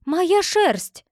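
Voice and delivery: high, loud cry of recognition; shout. A narrator's reading. Farmer's wife sees her stolen wool, high loud shout. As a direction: cry out as you spot your stolen wool. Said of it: "закричала Франсина Тассо".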